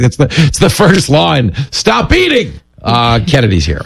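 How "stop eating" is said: high-pitched comic command; mock order. Acts out weight clause in contract.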